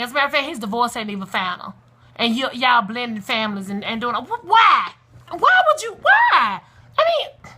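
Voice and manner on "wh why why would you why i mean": high-pitched, loud exasperated cry; emotional outburst. Real, not acted. Woman pleading with parents about their kids, emotional shouting. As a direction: shout it in disbelief, voice leaping way up. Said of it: "I'm getting emotional".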